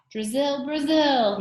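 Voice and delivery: high, loud gleeful shout; loud, high cheer of a giggling boy; laughing shout. A narrator's reading. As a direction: yell the rhyme with delight. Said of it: "he shouted".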